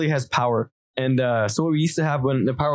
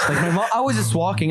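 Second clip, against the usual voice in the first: ordinary delivery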